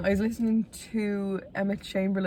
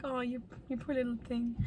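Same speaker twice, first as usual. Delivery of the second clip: pitying coo; 5 semitones higher, 8 dB quieter than usual. Retold or imitated. Cooing to her past self.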